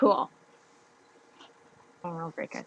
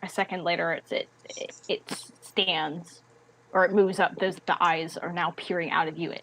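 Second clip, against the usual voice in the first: ordinary talk, nothing marked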